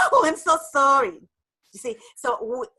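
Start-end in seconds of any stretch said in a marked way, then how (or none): 0.1-1.2 gushing apology. Retold or imitated. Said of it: "the inner dialogue".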